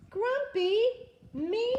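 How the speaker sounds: high, loud shocked disbelief; indignant surprise. A narrator's reading. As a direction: squeak out the outrage. Jim indignant at accusation.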